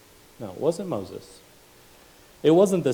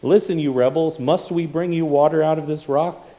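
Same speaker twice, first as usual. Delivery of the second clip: louder, higher, scornful rebuke; angry tone; 5 semitones higher, 7 dB louder than usual. Acted. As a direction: scold the rebels with anger. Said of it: "the anger and the negativity in the tone".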